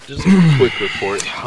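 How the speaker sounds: loud. Acted. Mock interviewer demand.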